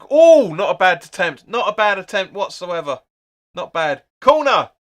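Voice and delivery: a real burst of excitement at a near miss, loud and high; on your feet at the telly, high and loud, cheering a chance; excited shouting. Real, not acted. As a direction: yell it out as the shot flies just wide. Big chance on goal, shouting.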